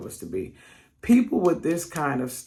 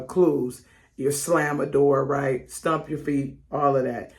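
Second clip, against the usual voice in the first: normal talking voice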